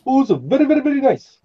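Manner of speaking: high, put-on Borat-style accent; Borat impression. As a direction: ham up the foreign accent for laughs. Borat voice bit continues.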